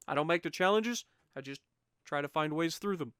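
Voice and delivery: ordinary talk, nothing marked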